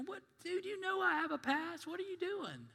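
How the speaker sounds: high, startled protest that fades to a hush; a touch of panic from the tempted friend; lightly voiced pleading friend; alarmed recovering alcoholic. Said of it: "And you go".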